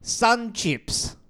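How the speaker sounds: high, loud, drawn-out over-the-top voice; exaggerated voice. Shown as a demonstration. Reading off the screen on cohost's request, hugely exaggerated. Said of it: "give it to me in an exaggerated voice".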